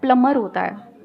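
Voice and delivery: "प्लम्बर" with the B left silent. Shown as a demonstration. Correcting a man on plumber, silent B. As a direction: say "प्लम्बर" as plummer, no B sound. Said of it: "बी silent है".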